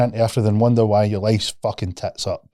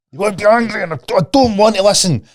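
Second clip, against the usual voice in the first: put-on high, loud sulky child voice; imitating a stroppy child, higher and louder; mock angry kid; 10 semitones higher, 4 dB louder than usual. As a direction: whine like a kid who won't listen. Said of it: "And they're like".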